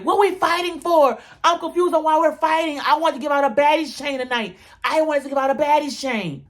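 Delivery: high, whiny, puzzled voice; confused whine. Retold or imitated. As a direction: play it baffled and pouty in a high voice. Natalie's confused quote, voiced.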